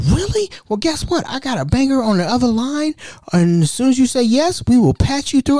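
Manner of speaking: very high, excited salesman; high telemarketer voice. Retold or imitated. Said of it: "They're like".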